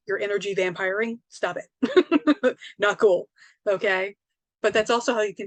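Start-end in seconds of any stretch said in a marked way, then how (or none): 1.3-4.1 mock-stern stop it, louder; playful scold. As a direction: tease them into stopping.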